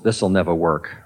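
a low, muttered sceptical aside; doubting thought voice. Retold or imitated. Teacher voicing a meditator's doubting thought, low and sceptical. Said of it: "then a thought comes".

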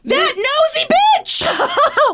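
high, slow gleeful yell; delighted shout. Real, not acted. Mock outrage, laughing.

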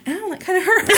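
very high, laughing pretend ouch; mock mild pain. Podcaster joking as woman hit by pellet, high mock ouch. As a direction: make light of being shot in a squeaky voice.

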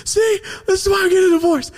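very high, fast imitation of helpless laughter; mock hysterical vindication, voice way up; dying laughing. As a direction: crow it excitedly, as if cracking up. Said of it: "he started dying laughing".

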